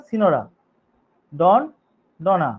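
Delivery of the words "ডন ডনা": normal talking voice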